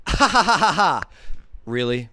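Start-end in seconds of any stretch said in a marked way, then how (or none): 0.1-1.0 mock maniacal laugh. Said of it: "He'll be like".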